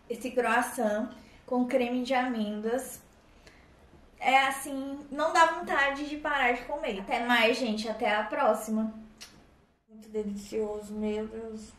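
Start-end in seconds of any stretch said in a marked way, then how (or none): 5.1-11.7 talking between bites; chewing while talking. Real, not acted. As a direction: mouth partly full. Seen 4: Holds croissant, lips closed chewing between words; hand to mouth.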